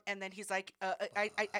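usual speaking voice

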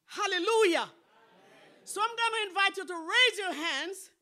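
very loud, high rallying call to the congregation; full-force pulpit shout. Real, not acted. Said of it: "raising my voice".

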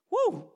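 high, loud joyful whoop; excited woo. Real, not acted. Preacher whooping with joy at Jesus's words, excited woo. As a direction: whoop with delight.

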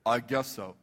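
low, grudging mumbled agreement; reluctant consent. Retold or imitated. Frank agreeing to hear the vision, reluctant. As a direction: give in warily, pitched well down. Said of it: "just like this".

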